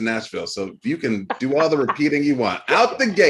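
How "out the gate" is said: put-on Southern accent. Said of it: "My Southern accent will be here".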